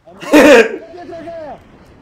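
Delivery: high, loud burst of laughter; explosive high cackle. Real, not acted. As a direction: cackle at full volume. Laughs at the glitch.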